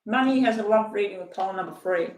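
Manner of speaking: ordinary talk, nothing marked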